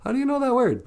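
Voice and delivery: high-pitched, astonished question; retold surprise. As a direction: act out delighted disbelief. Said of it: "I was like".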